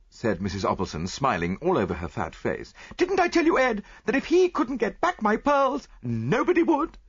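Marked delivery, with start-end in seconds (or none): 2.9-7.0 high, loud society matron; gushing rich lady. Acted. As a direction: gush like a proud wealthy wife. Mrs Opalson boasts to husband.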